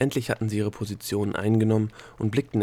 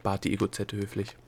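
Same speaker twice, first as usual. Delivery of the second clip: ordinary delivery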